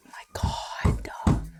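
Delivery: urgent whispered aside; whispering. Real, not acted. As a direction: keep it to a whisper. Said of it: "I have to whisper this".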